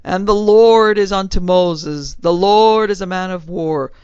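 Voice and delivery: loud, slow preacher's bellow from the pulpit; a preacher bellowing scripture at his congregation; bellowed sermon. Acted. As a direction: thunder the scripture out slowly. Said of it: "when he bellowed".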